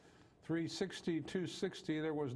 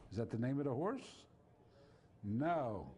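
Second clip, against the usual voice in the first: ordinary delivery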